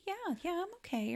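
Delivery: mock nonchalance; acted casual phone talk. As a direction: reassure the caller falsely. Pretending calm on phone, retold.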